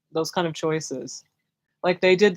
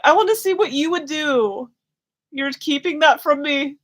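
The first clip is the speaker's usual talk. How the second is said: put-on high, louder childish plea; mock childish complaint, higher and louder; whiny younger self; 9 semitones higher, 4 dB louder than usual. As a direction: sulk like a kid left out.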